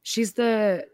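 put-on British accent. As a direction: play at sounding British. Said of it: "rest of the podcast in British".

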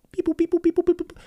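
high robot computing beeps; playful machine-thinking noises; robot beeping. Pretend. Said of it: "I'm formulating it now".

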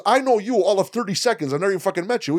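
high, loud indignant rant; mock outrage, voice pushed up; G ranting at the stranger, high and loud; angry friend. G's angry rant continues.

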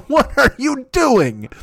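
high, slow laughing what; amused, stretched-out what; laughing disbelief. Real, not acted. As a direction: laugh it out incredulously.

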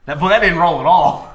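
laughing in disbelief. Real, not acted. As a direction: laugh it out at the bad roll. Laughs at bad roll.

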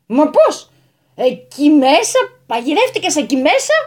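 loud, stunned exclamation, slow at first; can't believe what he sees; astonished disbelief. A narrator's reading. Landowner finds the old man stuck, astonished. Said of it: "έμεινε αποσβολωμένος".

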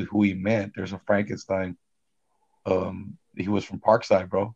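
ordinary delivery

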